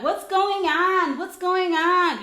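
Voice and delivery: high, slow, drawn-out mock concern; imitated coach. Coach walks over to the quarrel, imitated drawn-out questioning. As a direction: mimic a coach calling out, stretching each word.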